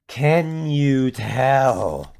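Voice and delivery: drawn-out teasing question; very slow tease. Real, not acted. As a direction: stretch it out knowingly. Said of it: "Can you tell that".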